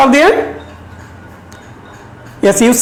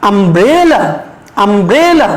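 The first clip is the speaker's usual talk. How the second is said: umbrella with the middle syllable stretched and rising; stress on BRE; 4 semitones lower, slower than usual. Shown as a demonstration. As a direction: lean on "bre" in umbrella, then fall away. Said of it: "এখানে আমরা একটু জোর দিয়ে বলবো".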